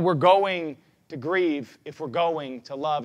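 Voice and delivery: ordinary talk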